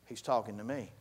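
low, hushed aside; quiet, low mock reaction from the pews; startled sleeper. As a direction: say it under the voice, as a private realization. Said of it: "everybody that was asleep went".

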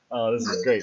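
usual speaking voice

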